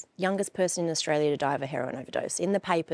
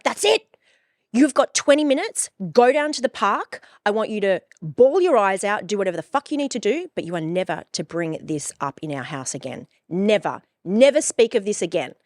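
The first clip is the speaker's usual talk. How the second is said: mum yelling; 3 semitones higher, 6 dB louder than usual. Retold or imitated. Mother ordering her grieving daughter, loud angry yell. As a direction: bark the rules at your kid.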